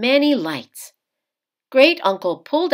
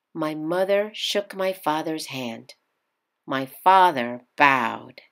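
ordinary delivery, nothing marked

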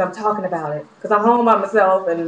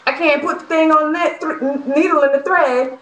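frustrated; 7 semitones higher than usual. Real, not acted. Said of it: "so I'm getting frustrated".

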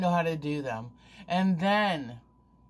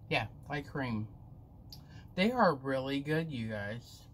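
candy in cheek; chewing while talking; 3 semitones lower, 4 dB quieter than usual. Real, not acted. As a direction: mouth partly full. Seen 5: Cheek bulges and lips pressed while she talks, looking down.

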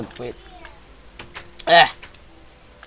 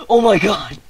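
loud, higher cry of shock; startled exclamation; 4 semitones higher, 8 dB louder than usual. Real, not acted. Player startled during the game, loud shocked cry. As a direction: blurt it out, really startled.

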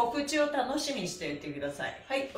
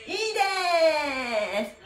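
excited gasp and cheer; 5 semitones higher, 4 dB louder, much slower than usual. Acted. Group call-and-response cheer.

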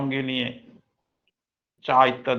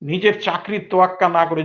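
usual speaking voice

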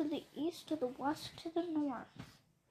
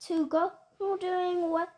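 angry yell; 5 dB louder than usual. Acted. Once-ler yells back at the Lorax, loud and defiant. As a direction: shout him down, rising in defiance. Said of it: "Then I got mad, terribly mad. I yelled at the Lorax".

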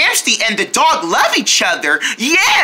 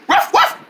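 barking like a dog; 11 semitones higher than usual. Pretend. Showing a dog's trick.

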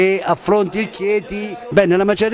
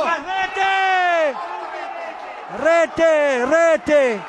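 goal shout, much higher pitch; breathless italian goal commentary; 9 semitones higher, much slower than usual. Real, not acted. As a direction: yell the goal with full joy. Goal call at match.

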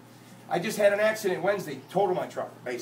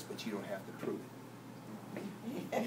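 normal talking voice

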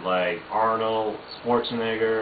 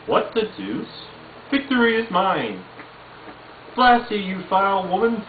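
put-on higher, haughty baby villain voice; Stewie impression; 10 semitones higher than usual. Stewie curses a woman, higher and haughty. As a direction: sneer it like Stewie, pitched up. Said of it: "Stewie Griffin from Family Guy".

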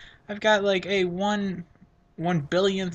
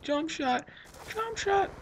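excited; 13 semitones higher, slower than usual. Real, not acted. Hoping for cool kill.

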